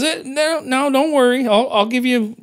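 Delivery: put-on breezy high old-timer, waving off the worry; old man voice. Old gambler soothing the agent, light high mock voice.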